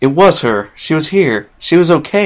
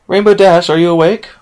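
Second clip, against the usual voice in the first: loud, higher call; Sky Blue calling loudly into the dorm; calling through a door; 7 semitones higher, 7 dB louder than usual. Acted. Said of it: "came the voice of Sky Blue from the other side".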